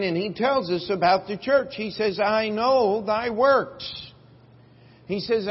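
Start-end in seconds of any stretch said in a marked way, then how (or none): 2.2-4.1 slow, weighty quote of Jesus; slow preaching. Acted. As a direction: draw out each word like scripture. Quoting Jesus slowly.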